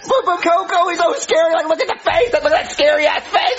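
scared whine. Acted. Scared of Coco.

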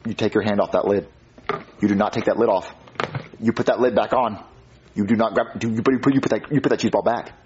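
low, measured parental warning; controlled, no-nonsense dad; stern warning. Acted. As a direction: drop low and give firm orders. Father warns child off cheese balls.

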